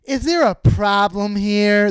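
hammed-up waiter stepping in; dude waiter impression. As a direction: draw it out as an intervening waiter. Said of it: "the dude waiter's like".

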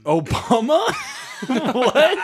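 loud, high, incredulous laughter; laughing disbelief. Real, not acted. Co-host reacting to a friend's mistake, laughing disbelief. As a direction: blurt it out while cracking up.

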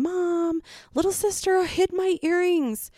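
put-on high-pitched child tattling to mom. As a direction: voice a kid calling Mom to complain. Retelling daughter tattling to mom.

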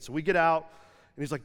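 ordinary talk, nothing marked

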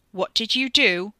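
loud, accusing question; heated, insistent demand. A narrator's reading. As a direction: demand an answer while being shoved. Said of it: "he demanded".

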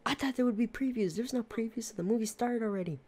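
high, whiny old lady voice, imitating; mock old lady complaint. Old lady back at the counter complaining about previews, mock high whine. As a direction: pitch it up like a put-out old lady. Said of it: "She's like".

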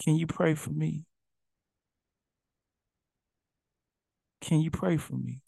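soft, small plea for prayer; pleading request. Retold or imitated. Humbled people asking the preacher to pray, softly. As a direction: ask quietly, humbled. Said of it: "people start saying".

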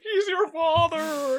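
high, slow, loud strained voice; Jordan Peterson impression. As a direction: stretch it out like a stern guru. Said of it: "You nailed it".

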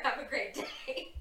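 slow, laughing goodbye. Real, not acted. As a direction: giggle through the words. Laughing sign-off.

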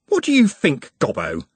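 high-pitched, confronting Trev; exasperated. A narrator's reading. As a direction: demand it right in his face. Trev grabs and spins Nut round.

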